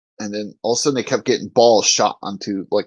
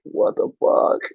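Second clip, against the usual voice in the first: whispery mock disbelief; hushed retold astonishment; baffled whisper; slower than usual. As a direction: whisper it, imitating your own bafflement. Teller's own baffled reaction, retold.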